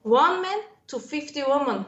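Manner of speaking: ordinary talk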